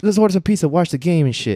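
high-pitched coaxing inner voice; baby soft voice. Acted. Inner voice tempting him to slack off, babyish coaxing. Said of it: "that baby soft voice, the one you wanna hear".

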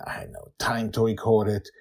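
usual speaking voice

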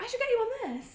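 high, put-on girly voice; imitating the girl. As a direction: do her as a breezy high-pitched fan. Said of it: "And she was like".

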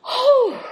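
high, loud whoosh of relief; big relieved exhale; relieved whew. Acted. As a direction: blow out a huge sigh of relief. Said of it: "we can now be like".

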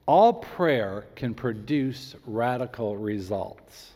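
slow and measured, each word placed; slow, careful. Shown as a demonstration. Pastor to congregation, slow careful emphasis. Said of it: "I'm choosing my words very carefully".

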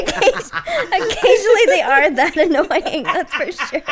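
high, loud words through laughter; laughing. Real, not acted. Admitting the birds are annoying, laughing. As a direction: crack up while agreeing.

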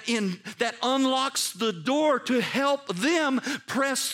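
high, urgent preacher's appeal; impassioned preaching. Real, not acted. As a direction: press the charge on them, drawing out the big words. Preaches at full force.

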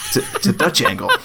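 put-on high-pitched New Zealand accent; Kiwi accent. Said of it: "That was a pretty good Kiwi accent".

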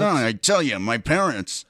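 loud, punched comedian impression; put-on old-school joke delivery; Rodney Dangerfield. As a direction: belt it out like an exasperated stand-up comic. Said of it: "you know, Rodney Dangerfield".

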